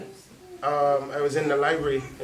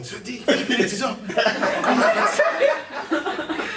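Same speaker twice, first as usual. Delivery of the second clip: high, louder mock yell of an auntie, laughing through it; imitated scream; 11 semitones higher, 7 dB louder, much slower than usual. Auntie Bisi summoning her nephew from the computer, a high laughing mock yell. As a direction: shout his name across the room like an impatient auntie. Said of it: "Auntie Bisi screamed again".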